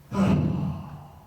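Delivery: long weary breathy sigh; exasperated sigh. Shown as a demonstration. Pastor shows the sigh a spouse gives when late for church. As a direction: let out a fed-up sigh. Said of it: "what that sigh meant".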